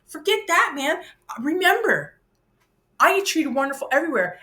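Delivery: high, loud burst of enthusiasm; excited exclamation. Real, not acted. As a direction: cut yourself off with a delighted outburst. Exclaiming, far higher and louder.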